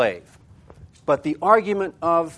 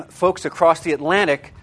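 ordinary talk

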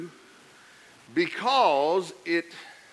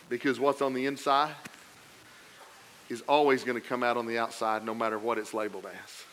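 quieter, lower and intimate; soft emphatic point; 5 semitones lower, 5 dB quieter than usual. Acted. Pastor stating the lesson, quiet and intimate. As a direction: drop the voice to make the point.

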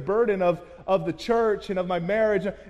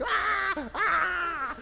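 very high imitated scream; mock the wheelchair screams, high; labor scream; 11 semitones higher than usual. Wife screaming in wheelchair, very high imitated. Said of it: "she's screaming".

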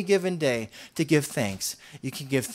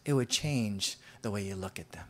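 whispered, much softer; hushed; 7 semitones lower, 8 dB quieter than usual. Real, not acted. Preacher closing his point to the congregation, whispering. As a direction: let it drop to a whisper.

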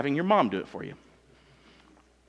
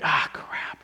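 muttered dismay. Retold or imitated. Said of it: "they're like".

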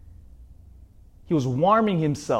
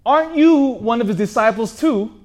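accusing servant; 8 semitones higher, 6 dB louder than usual. Acted. Servant girl accuses Peter.

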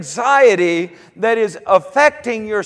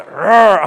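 mock growl; 6 dB louder than usual. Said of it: "if she growled".

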